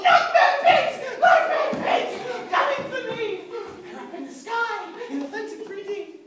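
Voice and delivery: high, loud roaring cry, eyes shut; fearful roar. Acted. Boy roars in fear as Auntie Em visits. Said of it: "his voice was raw as he called out in fear".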